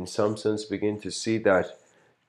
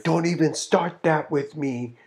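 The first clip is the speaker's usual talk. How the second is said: much higher, louder confronting voice; beast mode; 10 semitones higher, 4 dB louder than usual. Retold or imitated. Teacher confronting two girls, in mock fury. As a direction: shut them down hard. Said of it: "beast mode".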